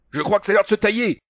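high, loud yell to flee; alarmed bellow; roaring. A narrator's reading. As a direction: roar that it's time to run. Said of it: "Rugit O'Donald".

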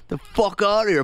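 high gruff retort; grumpy Farley. Retold or imitated. Farley yelling at the door, grumpy put-on voice. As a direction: snap it at the door. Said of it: "he goes".

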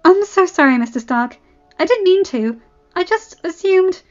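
high, hasty-to-excuse receptionist; apologise, embarrassed, until cut off; flustered apology. A narrator's reading.

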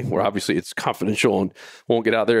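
usual speaking voice

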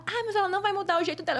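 lightly mimicked, high and quick; excuse-making assistant. Assistant saying the producer won't change, high quick mock excuse. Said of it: "a assistente dela".

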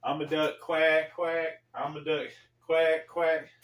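high, loud mock quacking like a duck; playing the duck to be laughed at; humiliated duck act. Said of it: "You go".

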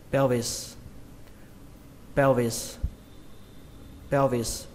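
"pelvis" run together as PEO-vis; quick blended pronunciation. Shown as a demonstration. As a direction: blend "pelvis" smoothly, falling on the second syllable. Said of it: "Các bạn đọc nhanh là". (đọc nhanh, liền "pelvis" thành peo-vis)

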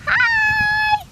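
high-pitched doll greeting; a long, high squeal of hello; Pepper. Pretend.

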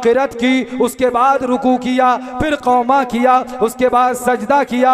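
loud, measured declamation with stretched endings; full-force preaching. Real, not acted. Preacher narrates the man stuck in prayer, declaiming. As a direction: step through the story in a ringing chant.

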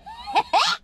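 high, short burst of laughter; quick high cackle. Real, not acted. Laughing after calling out.

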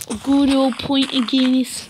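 a light mock Irish accent. Self-admitted bad Irish accent for his viewers. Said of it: "I don't have a good Irish accent".

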